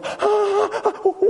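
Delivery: high, theatrical gasp; shocked gasp. Acted. Psychiatrist hears he quit all pills, shocked gasp. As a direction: gasp in exaggerated horror.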